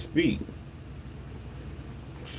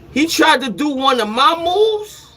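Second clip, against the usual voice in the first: incredulous outrage; 5 dB louder than usual. Retold or imitated. Outraged at copycat rival.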